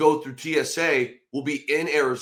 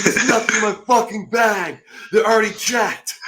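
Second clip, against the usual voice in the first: angry snap; 9 semitones higher, 8 dB louder than usual. Retold or imitated. Teammate snapping at Luke on the plane, angry shout. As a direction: bark it back, fed up. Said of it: "He's like".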